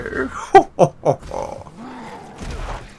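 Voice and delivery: drawn-out, high theatrical villain laugh. Acted. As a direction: laugh long like a hammed-up villain. Villain laugh inside Bane impression.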